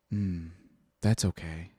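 low, soft priest voice; lightly imitated priest, hushed and low; retold soothing priest. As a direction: reassure the boy softly and low. Retold priest reassuring the boy.

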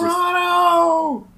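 long, high, drawn-out scream. Acted. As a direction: scream the name across the room. Player screaming a name.

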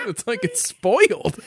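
loud shout of disgust at the taste; disgusted outburst. Real, not acted. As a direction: blurt out the disgust.

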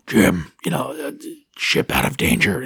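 imitated raspy movie deathbed voice; raspy dying voice. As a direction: rasp it out deep, with effort. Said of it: "The raspy voice".

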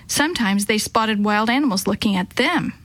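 ordinary delivery

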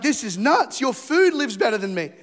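loud, high-pitched disbelief; comic outrage. Acted. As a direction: burst out like a flabbergasted kid. Acts child stunned by the pantry.

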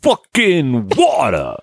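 slow, hammed-up Jersey accent, dropping the R in "water". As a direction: lean hard into a Jersey "water". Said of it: "Isn't that like Jersey as well?".

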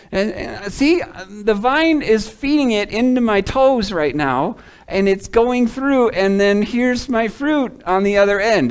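high, loud sermon delivery; impassioned preaching. Real, not acted. Preacher showing congregation the vine feeding fruit, impassioned preaching.

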